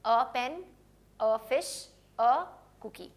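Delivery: each "a" said "uh", as in formal British English; spaced-out "uh pen, uh fish, uh cookie"; article A as "uh". Shown as a demonstration. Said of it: "British English है ज़्यादा formal". ("a" को "अ" बोलना)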